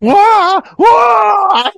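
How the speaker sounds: high, loud imitated wail of pain; pain scream. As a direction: scream like a wimp hit by shrapnel. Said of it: "He's just absolutely going, dialing it at eleven".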